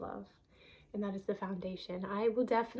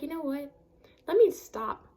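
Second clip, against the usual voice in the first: loud, higher firm command to herself; firm self-command; 5 semitones higher, 8 dB louder than usual. Retold or imitated. Telling herself to stop, a loud firm command. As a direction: stop yourself short, out loud. Quoted self-talk, snapping out of it.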